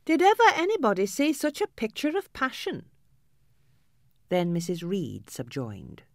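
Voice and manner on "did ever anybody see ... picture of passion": much higher, outraged servant voice; scandalised maid. A narrator's reading. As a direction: maid appalled at the girl's temper. Maid exclaims at girl.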